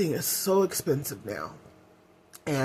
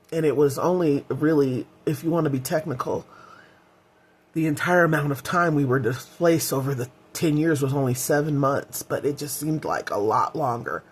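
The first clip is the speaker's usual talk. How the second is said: ordinary talk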